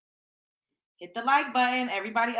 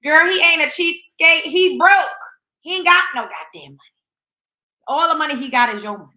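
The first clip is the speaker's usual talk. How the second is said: loud, higher, fast yell; 4 semitones higher, 11 dB louder than usual. Real, not acted. Yelling back at the woman in the clip, outraged. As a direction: shout it at her, scandalized.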